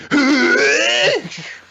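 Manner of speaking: long, high mock wail; high squeal. Guessing Asta's reaction, over-the-top high squeal. Imitating a possible silly reaction.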